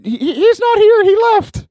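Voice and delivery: put-on very high, stammering little kid voice; eight-year-old boy. Little brother explains Seth is gone, high child voice. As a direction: mimic a small boy piping up, half laughing. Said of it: "Seth's eight-year-old brother".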